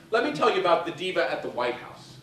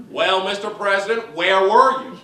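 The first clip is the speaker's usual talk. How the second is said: challenging mother; 4 semitones higher, 8 dB louder than usual. Retold or imitated. Mother confronting the president.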